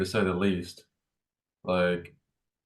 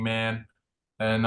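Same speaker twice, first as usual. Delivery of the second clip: normal talking voice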